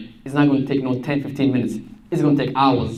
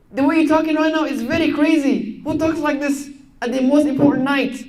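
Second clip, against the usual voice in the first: shocked mother; 12 semitones higher than usual. Retold or imitated. Mother scolding her daughter, high shocked voice. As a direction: screech it as a scandalised mother. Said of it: "Her mom was like".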